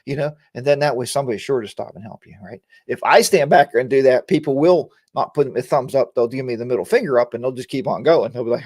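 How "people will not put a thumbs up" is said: ordinary talk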